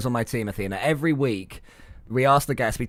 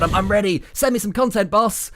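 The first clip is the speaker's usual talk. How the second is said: higher-pitched, lightly acted keen employee; eager waiting editor; 6 semitones higher than usual. Editor begging the boss for content, eager and higher. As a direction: play an editor keen for work. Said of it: "That poor editor has been sat at his desk".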